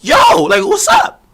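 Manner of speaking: very high, exasperated yell; retold pissed-off challenge. Streamer replays confronting the woman over the pill, pissed-off yell. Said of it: "So now I'm pissed".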